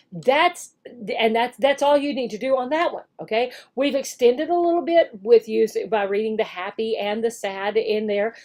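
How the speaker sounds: ordinary talk, nothing marked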